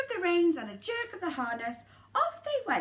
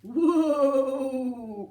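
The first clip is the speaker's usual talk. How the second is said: loud startled Santa cry; Santa cries out; 8 dB louder than usual. Acted. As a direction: shout in surprise as Santa. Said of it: "cried Santa".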